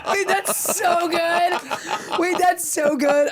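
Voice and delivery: high, drawn-out delight through laughter; real delighted laughter. Real, not acted. Medium reacting to the co-host's joke, high and laughing. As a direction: squeal it slowly, cracking up.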